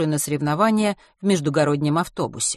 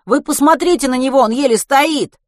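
high, loud urgent appeal; yelled alarmed protest; indignant shout; 10 semitones higher, 9 dB louder than usual. A narrator's reading. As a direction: call after someone, demanding they look. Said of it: "крикнул ей вслед Матвей".

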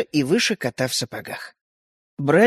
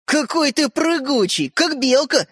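put-on slow, lilting boy's delight, pitched higher; admiring; 8 semitones higher, slower than usual. A narrator's reading. Boy marveling at the tiny jumper, delighted. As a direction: marvel at how the toy can jump. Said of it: "восхитился Родька".

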